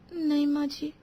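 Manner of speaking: small, soft high reply; meek. Acted. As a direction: submit timidly to mother-in-law. Scolded bride answers meekly. (सहमी हुई धीमी आवाज़)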